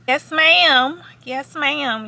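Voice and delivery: a hint of a Southern drawl on yes ma'am. Southern locals answering her with a polite yes ma'am. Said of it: "I love a southern accent".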